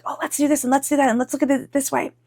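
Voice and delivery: high, light, eager rush of ideas; eager brainstorming. Acted. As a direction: act out bubbling over with plans. Acting restless eager energy.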